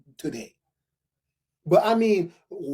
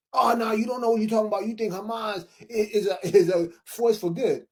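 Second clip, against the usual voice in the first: mocking critics; 5 semitones higher than usual.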